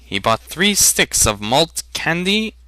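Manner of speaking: plain reading voice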